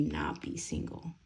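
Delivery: slow, secretive whisper; whispered warning. Real, not acted. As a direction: lean in and whisper it slowly.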